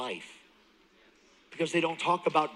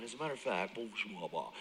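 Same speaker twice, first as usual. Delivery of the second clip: dismissive mumbling; 4 semitones lower, 9 dB quieter, faster than usual. Retold or imitated. Mimicking counselor's deep theological talk.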